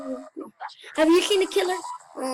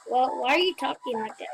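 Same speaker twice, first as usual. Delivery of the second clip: usual speaking voice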